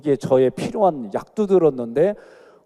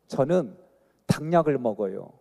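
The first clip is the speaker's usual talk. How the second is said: usual speaking voice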